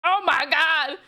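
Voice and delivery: loud shocked cry. Real, not acted. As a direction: blurt it out in horror. Horrified at the monster.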